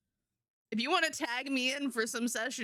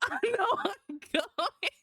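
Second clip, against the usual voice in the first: laughing high; 10 semitones higher than usual. Real, not acted.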